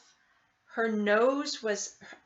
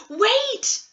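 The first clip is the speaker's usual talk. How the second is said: loud, high "Wait!"; sudden high desperate cry; cried out; 13 semitones higher, 8 dB louder than usual. A narrator's reading. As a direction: call her back urgently. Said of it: "she cried".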